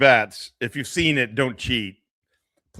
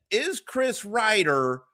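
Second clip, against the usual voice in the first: mocking question; 7 semitones higher, slower than usual. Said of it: "wonder in your head".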